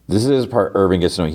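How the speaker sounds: normal talking voice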